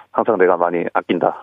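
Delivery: usual speaking voice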